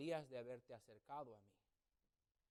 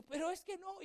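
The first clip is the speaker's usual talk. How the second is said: put-on protesting boy; 4 dB louder than usual.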